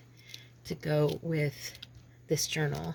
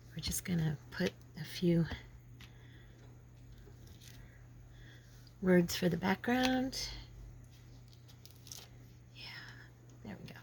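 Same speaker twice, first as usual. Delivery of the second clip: whispering; 11 dB quieter than usual. Real, not acted. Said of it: "crafting aside, whispered".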